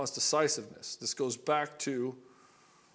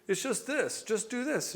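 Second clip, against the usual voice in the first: a higher, breezy mock of a know-it-all; fixer boyfriend; 9 semitones higher than usual. A boyfriend handing his upset partner a quick fix, in a higher, breezy mock voice. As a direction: brush her problem off with an easy fix. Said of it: "he thinks he's doing her a service".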